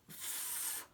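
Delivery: unvoiced f, just breath through the teeth; f sound. Shown as a demonstration. Teacher isolating the f sound in full. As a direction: hiss the f sound with no voice. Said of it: "says ol".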